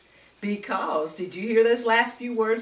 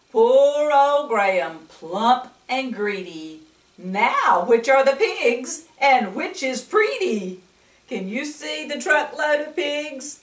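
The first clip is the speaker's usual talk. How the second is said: put-on bright, louder read-aloud for little kids, laughing along; lively picture-book reading; 4 semitones higher, 5 dB louder than usual. Storyteller reading to young children, lively and louder.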